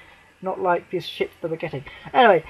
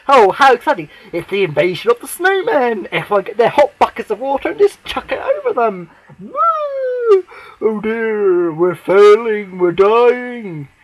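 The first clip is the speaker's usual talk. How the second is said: sarcastic mock panic; 2 semitones higher, 7 dB louder than usual. Fan mocking a snowman invasion, loud sarcastic panic.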